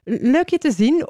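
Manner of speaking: high, friendly call downward. A narrator's reading. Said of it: "riep Zebedeus naar beneden". (vriendelijk naar beneden roepend)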